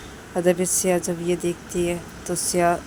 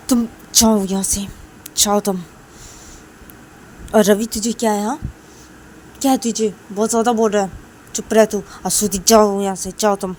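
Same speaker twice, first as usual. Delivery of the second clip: louder, snapping at them to leave; elder sister shooing Shruti away, louder and angry; angry dismissal; 2 semitones higher, 7 dB louder than usual. Acted. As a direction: order her out of the room, fed up. Siya ordering Shruti away.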